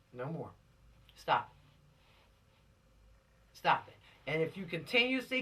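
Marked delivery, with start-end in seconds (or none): 0.1-3.6 breathy whisper, low and drawn out; whispered plea. Real, not acted. Preacher quietly warning offenders to stop, whispered. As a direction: hush it like a secret warning.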